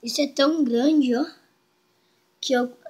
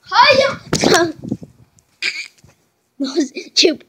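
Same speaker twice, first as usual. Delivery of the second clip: pained cry; 4 semitones higher, 8 dB louder than usual. Real, not acted. Got hurt, crying out.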